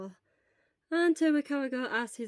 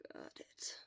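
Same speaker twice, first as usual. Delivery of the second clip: soft unvoiced whisper; weak whisper; 15 dB quieter than usual. Weak patient whispering at his chattering friend. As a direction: hush him with barely any voice.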